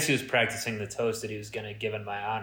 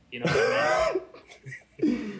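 suggestive; 13 semitones higher than usual. Acted. Innuendo about matching hair colours.

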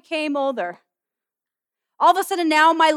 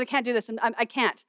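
low, softer defeated admission; weary I can't; 5 semitones lower, 6 dB quieter, faster than usual. Retold or imitated. Preacher retelling how she told James she gave up, low and soft. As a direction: sigh it out like you've given up.

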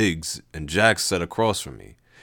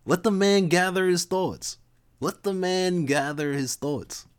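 much higher, slow laid-back bro; Levi voice; 11 semitones higher than usual. Acted. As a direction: tell them to give him room, chill. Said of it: "that Lev sound".

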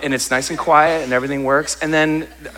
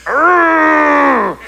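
much higher, louder growl of frustration; frustrated growl; 7 dB louder than usual. Acted. Pastor venting dishwasher resentment, frustrated growl. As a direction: let out a fed-up growl.

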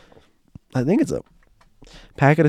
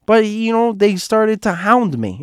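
Joanne; 11 semitones higher than usual. Retold or imitated. Joanne complains about security hounding her, aggrieved. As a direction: whine about it in a high put-on voice. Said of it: "this is my impression of her".

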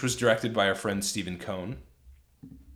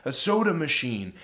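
higher, stammering weakling voice; shrimpy old man; 9 semitones higher, 4 dB louder than usual. Retold or imitated. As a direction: play the cornered creep, pitched up and stuttering. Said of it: "the guy goes like".

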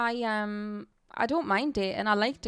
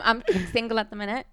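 ordinary talk, nothing marked

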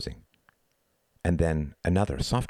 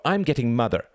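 put-on raised, protesting boy's voice; higher-pitched boyish complaint; crying out; 6 semitones higher, 4 dB louder than usual. A narrator's reading. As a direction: call out the threat as a boy. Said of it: "cried Reginald".

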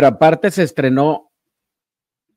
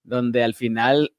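usual speaking voice

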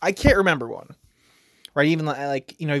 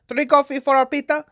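put-on higher, louder Indian English accent on the barista line; playful imitation of an Indian barista's accent; 11 semitones higher, 4 dB louder than usual. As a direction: put on an Indian accent, not quite perfect. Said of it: "I did the accent".

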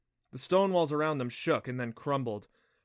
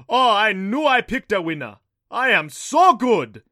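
very loud, high, booming crow; triumphant boast; 9 semitones higher, 15 dB louder, slower than usual. A narrator's reading. Thunder god boasts of picking a winner, booming. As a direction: crow it hugely pleased with yourself.

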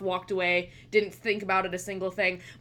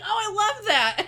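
high-pitched gush through laughter; mock gushing; 11 semitones higher than usual. Retelling gushing over the porch.

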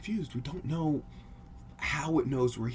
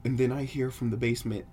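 ordinary delivery, nothing marked

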